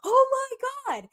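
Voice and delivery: high, loud imitation of a gasping crowd; high-pitched mock crowd outcry; crowd exclaiming. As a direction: voice a whole crowd's shocked cry. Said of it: "the whole crowd goes".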